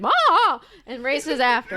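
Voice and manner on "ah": loud wordless scream of rage; rage wail. Acted. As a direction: shriek with fury. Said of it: "shrieks an incoherent wail of rage".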